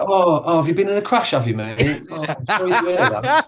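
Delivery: high, sing-song fake concern, laughing; mocking sympathy. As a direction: ham up fake pity to tease him. Mates mocking his crash sympathy.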